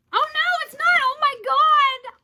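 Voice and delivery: high, loud shriek of surprise; shocked outburst. Real, not acted. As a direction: cry out in thrilled disbelief. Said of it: "I'm so shocked".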